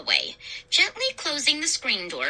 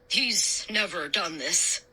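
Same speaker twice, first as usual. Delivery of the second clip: slow, lowered apology; apologetic; 4 semitones lower, 4 dB quieter than usual. A narrator's reading. Dad excusing Petey to Mister Dawes, apologetic. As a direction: say it slowly, excusing the dog. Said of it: "Dad said apologetically".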